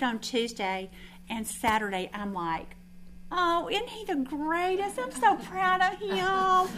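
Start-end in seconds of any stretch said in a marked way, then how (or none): 3.3-6.7 high, slow, doting coo; mock-doting praise of her son; gushing mom. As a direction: gush like a proud mother. Mother gushing over son.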